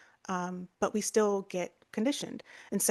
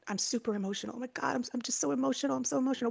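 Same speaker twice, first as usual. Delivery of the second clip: mock emotional self-talk; 5 semitones higher, faster than usual. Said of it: "believing and repeating the statement".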